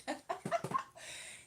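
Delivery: high breathy giggle; high laugh. Real, not acted. Laughing about texting guy.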